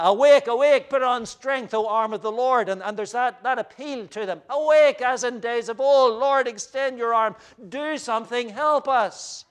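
high, loud, frantic cry to God; hysterical plea. Retold or imitated. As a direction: plead in a panic, voice climbing. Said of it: "we caught that note of hysteria in their voice".

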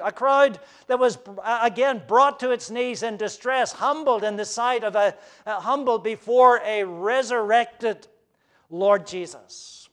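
loud, high fervent preaching; impassioned sermon delivery; full-force preaching. Acted. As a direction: drive the story home from the pulpit.

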